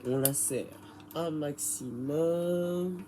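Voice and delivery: ordinary talk, nothing marked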